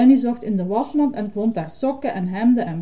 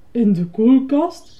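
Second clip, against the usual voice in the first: loud, slow astonished question; incredulous cry; 3 semitones higher, 6 dB louder, slower than usual. Acted. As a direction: exclaim it in disbelief. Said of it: "riep Bert". (verbaasd uitroepen)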